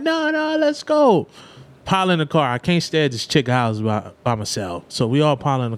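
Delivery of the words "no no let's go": high, pushy insistence; imitating the friends urging everyone on; mock eager friends. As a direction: hurry them along.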